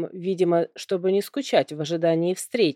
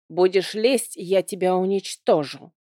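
plain reading voice

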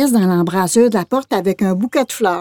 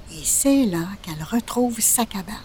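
hushed storyteller whisper; 8 dB quieter than usual. A narrator's reading. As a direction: whisper it like a secret. Narrator drops to whisper.

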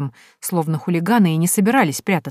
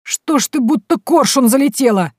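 put-on scolding old man; 8 semitones higher, 5 dB louder than usual. A narrator's reading.

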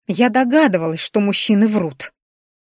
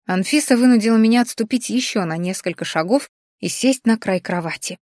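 plain reading voice

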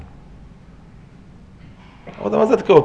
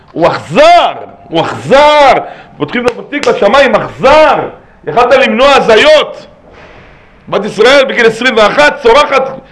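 loud, high-pitched preaching at full force; impassioned rebuke; 7 semitones higher, 7 dB louder than usual. Real, not acted. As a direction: thunder the accusation at the congregation. (תוכחה נלהבת בצעקה)

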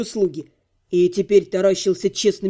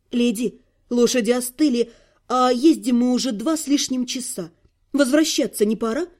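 put-on higher boyish voice prompting politely; young servant boy; 4 semitones higher than usual. Servant boy reminding the lady it is time to ride back, higher boyish voice. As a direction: nudge your mistress as a young lad.

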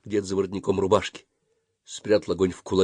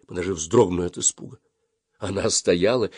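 plain reading voice

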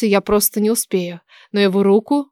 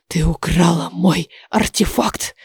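low, slow dragon hiss; hissed accusation; 4 semitones lower, slower than usual. A narrator's reading. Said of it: "Дракон наклонился и прошипел". (низкое медленное угрожающее шипение)

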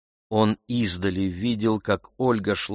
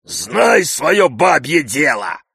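high, loud, drawn-out scolding; angry shout; 6 semitones higher, 7 dB louder, slower than usual. A narrator's reading. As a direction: yell it slowly to put the woman in her place. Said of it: "закричал он".

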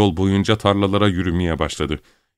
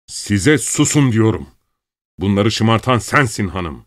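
raised voice climbing to a high shout; enraged tirade; 5 semitones higher, 4 dB louder than usual. A narrator's reading. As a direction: build to throwing the whole family out. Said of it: "Sinirden gözlerinden yaş akıyordu".

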